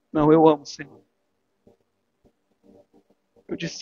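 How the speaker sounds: ordinary talk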